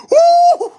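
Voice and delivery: loud high woo; excited whoop. Real, not acted. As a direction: whoop with delight. Cheering at picture.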